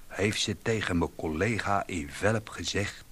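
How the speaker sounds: ordinary delivery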